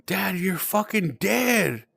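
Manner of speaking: high, drawn-out mock-tearful insistence; retold crying plea. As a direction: plead it slowly, retelling a tearful cry. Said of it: "I start crying".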